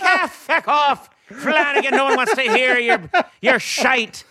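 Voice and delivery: high, loud Irish brogue; imitated Irish accent. Mock-scolding Flanagan for his stories, high Irish accent. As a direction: put on a scoffing Irish voice. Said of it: "And then I start talking that way".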